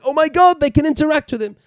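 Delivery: high excited squeal. Retold or imitated. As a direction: gasp it out, thrilled and high. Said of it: "I was like".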